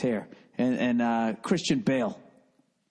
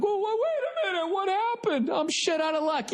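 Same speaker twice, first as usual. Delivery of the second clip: mocking dopey homebuyer. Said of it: "they're like".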